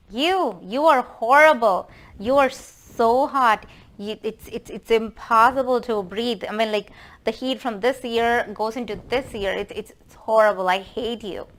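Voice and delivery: mock outrage, punched hard then easing off; acted scolding. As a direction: scold summer as an exasperated mother-in-law. Mother-in-law scolds summer season.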